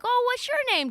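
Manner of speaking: put-on high-pitched kid-directed question; talking to a child. Host turns to the sister, high talking-to-kids voice. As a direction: ask it high, as to a small child.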